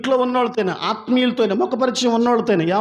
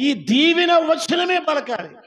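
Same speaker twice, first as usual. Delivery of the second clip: higher, slow, ringing proclamation; preaching shout; 6 semitones higher than usual. Acted. Pastor commanding the congregation, slow preaching shout. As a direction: proclaim it slowly to the rafters.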